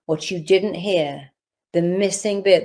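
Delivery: normal talking voice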